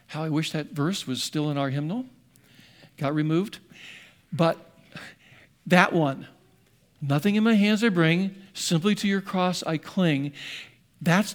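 ordinary talk

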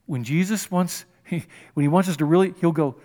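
ordinary talk

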